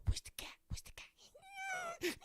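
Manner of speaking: high, drawn-out breathy squeal at the dog; hyping up a dog. Retold or imitated. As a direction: wind the dog up, slow and shrill. Owner hypes the dog up.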